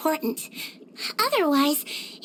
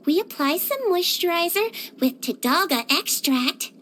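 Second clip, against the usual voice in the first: ordinary talk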